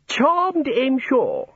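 very high, affected social greeting; genteel housekeeper. A narrator's reading. Housekeeper being introduced to a god, affectedly genteel. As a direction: greet a grand guest in a high, swooping voice.

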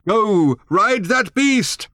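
high, slow loud call; old man yelling after the riders; shouted. A narrator's reading. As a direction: bellow it after them. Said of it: "shouted Thrashbarg".

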